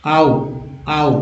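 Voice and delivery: आओ said as a gliding "ow" sound. Shown as a demonstration. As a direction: repeat आओ separately, teaching the diphthong. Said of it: "उच्चारण आ हुआ".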